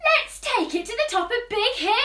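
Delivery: the dog eagerly suggests the hill; excited. Acted. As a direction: bubble over with the idea.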